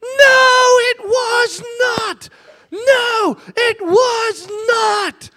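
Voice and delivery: put-on high wailing little girl yelling No it was not; crying child's shout. Father imitating his daughter's sobbing high wail. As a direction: shriek it high like a sobbing eight-year-old. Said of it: "my eight-year-old daughter going".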